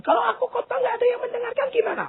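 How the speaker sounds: a fretting voice; fast, high worried whine. Retold or imitated. Preacher mocks a timid preacher's fear, high and fast. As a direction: rush the worry out, pitched high. Imagined fearful preacher's worry.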